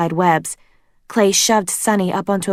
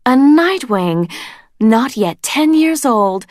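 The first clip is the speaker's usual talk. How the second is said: slow, high sneering queen; Queen Scarlet voice; 6 semitones higher, 4 dB louder, slower than usual. A narrator's reading. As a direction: purr it like a cruel queen.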